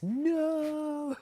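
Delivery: high, drawn-out no of protest; mock horror. Owner rejecting a heaven with only cats, mock horror. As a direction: cry out in exaggerated dismay.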